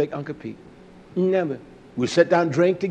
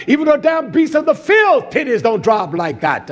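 outraged shout; 7 semitones higher, 4 dB louder than usual. Real, not acted. Shouted outrage mid-sermon.

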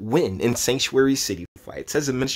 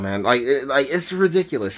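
ordinary talk

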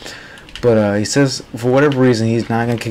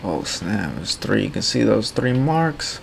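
ordinary delivery

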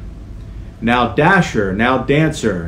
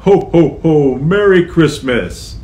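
louder, higher Santa ho ho ho; jolly Santa laugh; 5 semitones higher, 5 dB louder than usual. Acted. Santa greeting the children at story time, jolly.

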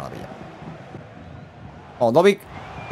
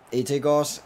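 ordinary delivery

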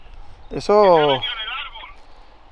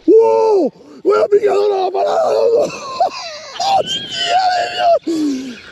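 excited shouting; 13 semitones higher, slower than usual. Real, not acted. Fish strikes, excited shouting. (gritos de emoción)

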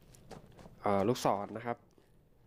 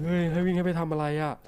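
exasperated shout; 6 semitones higher, 5 dB louder than usual. Real, not acted. Game forces him to run.

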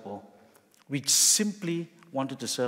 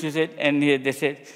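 ordinary delivery

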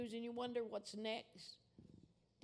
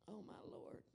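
breathy awe; 9 dB quieter than usual. Real, not acted. Overcome during the altar call.

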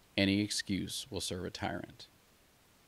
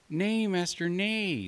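much higher little lamb voice; timid lamb; 11 semitones higher, slower than usual. Acted. Lamb pleading with the wolf, high and timid. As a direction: plead meekly like a small lamb.

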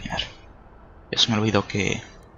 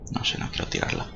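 whispering; 13 dB quieter than usual. Real, not acted. Muttering while dropping sword.

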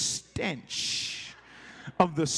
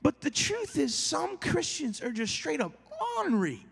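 ordinary delivery

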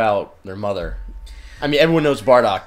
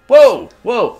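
high startled exclamations; startled whoa; 13 semitones higher, 10 dB louder than usual. Real, not acted. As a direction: gasp out in surprise. Sudden game event.

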